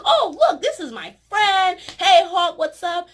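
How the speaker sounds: high, loud, chummy mock Thor; Thor greeting Hulk. Vlogger playing Thor greeting his friend Hulk, high and chummy. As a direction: sing out a cheery hello to an old buddy. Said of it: "He's like".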